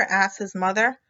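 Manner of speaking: ordinary delivery, nothing marked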